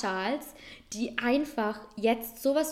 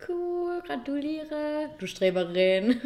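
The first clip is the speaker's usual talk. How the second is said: teasing; 7 semitones higher, slower than usual. Real, not acted. Teasing friend over good grade.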